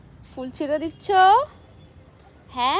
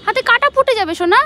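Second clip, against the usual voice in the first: alarmed warning; 8 semitones higher, 8 dB louder than usual. Real, not acted. Mother warns her little girl off the thorns, alarmed and loud.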